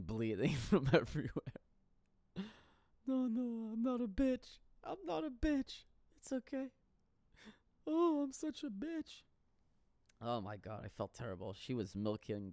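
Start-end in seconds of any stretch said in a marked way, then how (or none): 3.1-9.2 much higher mock-whiny girlfriend voice. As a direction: play her up, high and pitiful. Voicing crying girlfriend after crash.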